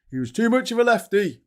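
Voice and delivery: high, loud squawk of complaint; mock outrage. Mocking why the BBC got rid of Lineker, mock outrage. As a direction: parody the complainer, pitched right up and loud. Mocks the complaint just stated.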